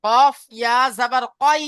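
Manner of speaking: "কফ ইয়া জবর কই" said slowly, stretching the final কই; drawn-out teaching demo of "কই"; slow careful model. Shown as a demonstration. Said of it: "উচ্চারণ সর্বদাই আই".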